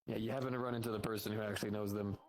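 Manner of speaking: ordinary talk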